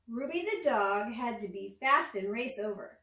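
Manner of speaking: ordinary delivery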